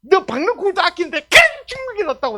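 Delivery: high, loud prophet's complaint; aggrieved protest. Acted. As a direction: shout the grievance up at God. Acts Jonah complaining to God.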